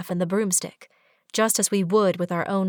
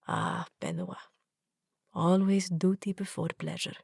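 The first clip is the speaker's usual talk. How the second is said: fond sigh; 6 dB quieter than usual. Acted.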